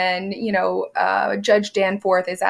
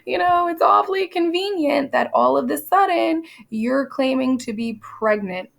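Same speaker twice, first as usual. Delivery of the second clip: skeptical judge; 9 semitones higher than usual. Retold or imitated. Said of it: "He's like".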